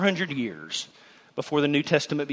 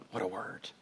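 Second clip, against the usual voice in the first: hushed echo; 7 semitones lower, 16 dB quieter than usual. Shown as a demonstration. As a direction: repeat it much softer and lower. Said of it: "repeated hushed".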